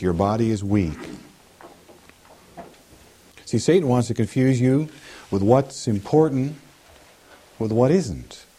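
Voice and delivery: ordinary talk, nothing marked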